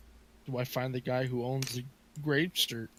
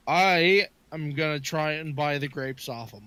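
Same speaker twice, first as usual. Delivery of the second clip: ordinary talk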